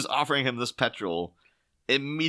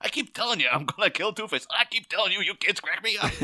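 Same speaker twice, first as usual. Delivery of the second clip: mock Dick Grayson; 7 semitones higher than usual. Mock Dick and Bruce exchange.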